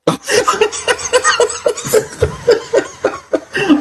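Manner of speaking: loud, high burst of laughter; helpless loud cackling laugh; big laugh. Real, not acted. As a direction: crack up hard and long.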